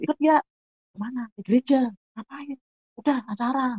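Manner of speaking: high-pitched imitated back-and-forth; acted friends' exchange. Two friends arranging a church outing, high acted voices.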